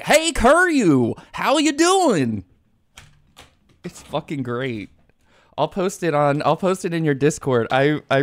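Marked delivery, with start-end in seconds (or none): 0.0-2.4 high, slow goofy drawl; slow high cartoon Goofy greeting. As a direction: stretch it out like Goofy. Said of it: "he's like".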